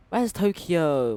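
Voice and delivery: drawn-out loud question; loud slow call. Real, not acted. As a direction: call it out slowly. Searching for the city.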